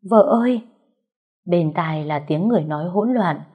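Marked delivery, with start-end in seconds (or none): 0.1-0.6 desperate calling, rising louder; husband calling his fading wife. A narrator's reading. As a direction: call her name in panic. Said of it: "tiếng Phong gọi".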